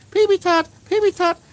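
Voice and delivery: very high squeaky silly chant. Acted. Goofing around for the room in a squeaky high chant. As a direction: squeal it like a cartoon child.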